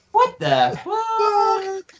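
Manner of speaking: very high, very slow squeal; furry voice. Pretend. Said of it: "You have to do the furry voice".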